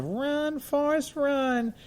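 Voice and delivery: put-on high, childlike call of run forrest run; little girl shouting encouragement. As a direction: squeak it out like the little girl urging him on. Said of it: "and the little girl said".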